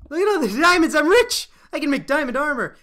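high, loud squeal of joy; ecstatic shout. Real, not acted. Player spotting a pile of diamonds, ecstatic shout. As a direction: yell it like you just struck gold.

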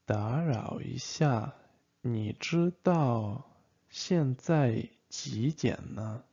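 你知道现在几点呢 spoken slowly, syllable by syllable; slow, careful teacher model; slow repeat. Shown as a demonstration. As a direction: drag it out for learners to copy.

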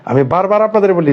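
usual speaking voice

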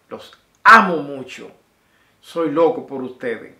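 loud, exuberant goodbye; loud farewell. Real, not acted. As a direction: call it out with big affection. Affectionate farewell, two takes.

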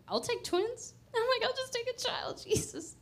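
very high, comic begging squeak; humorous pleading. Retold or imitated. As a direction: bargain with God in a tiny high voice. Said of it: "I say that with some humor".

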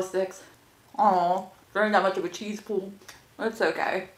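speaking between bites; talking while chewing. Real, not acted. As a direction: mouth partly full of food. Seen 0: bites stick at -1.0, cheeks full, chewing between words.

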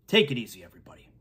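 winded and panting after the bit; out of breath. Real, not acted. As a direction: gasp through the words, winded. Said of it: "I gotta catch my breath".

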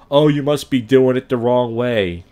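slow, condescending know-it-all; a lightly voiced smug coach, slow and pushy; mock smug coach. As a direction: talk down like a smug pickup guru. Mocking the pickup coach's pitch.

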